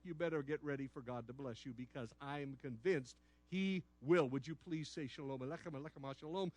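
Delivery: quiet, sincere pastoral appeal; a pastor gently inviting a blessing; earnest soft blessing. Acted. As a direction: soften into a heartfelt invitation.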